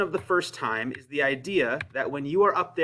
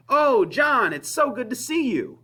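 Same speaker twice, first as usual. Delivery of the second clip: high, loud, delighted welcome; excited greeting; 9 semitones higher, 5 dB louder than usual. Shown as a demonstration. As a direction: greet them with gushing excitement. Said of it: "subtext of the first one is that I am excited".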